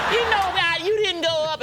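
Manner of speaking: high, loud griping; mocking complaint. Brothers riding home to their comedian, mocking complaint.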